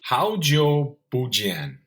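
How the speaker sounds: ordinary talk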